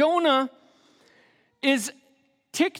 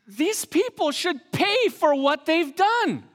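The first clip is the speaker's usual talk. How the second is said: indignant thought; 7 semitones higher than usual. Retold or imitated. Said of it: "for him to think".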